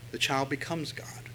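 put-on the child becomes God whispered. Shown as a demonstration. Preacher warning parents, hushed whisper. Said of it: "sermon point".

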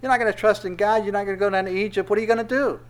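high, loud jeering at the king; scornful calling out; mocking general. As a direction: taunt Hezekiah from below the wall. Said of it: "He goes".

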